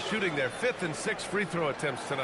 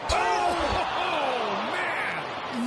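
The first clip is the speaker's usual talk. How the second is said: excited; 8 semitones higher, 4 dB louder, much slower than usual. Real, not acted. Big play reaction.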